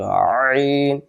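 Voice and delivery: আইন begun with a tight, creaky squeeze in the throat; pressed throat Ain. Shown as a demonstration. Teacher shows a little pressure is needed, throat-pressed আইন. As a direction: squeeze mid-throat as you open আইন, holding it long. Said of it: "চাপ লাগবে একটু".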